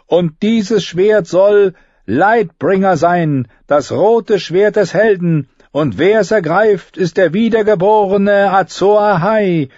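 put-on high, solemn priestess voice reciting the old prophecy; Melisandre's prophecy. Priestess reciting prophecy to assembled host, high solemn voice. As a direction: intone it like a fire priestess.

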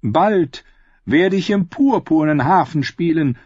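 high, forceful boast of future success. A narrator's reading. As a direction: brag grandly as the singer. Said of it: "prahlte er". (großspurig prahlend, mit hoher Stimme)

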